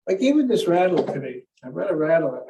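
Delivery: usual speaking voice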